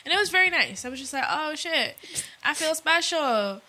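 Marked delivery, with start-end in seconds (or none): 1.3-3.6 higher, bright, delighted; playful excitement. Retold or imitated. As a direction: gush like you feel special. Quoting her past self.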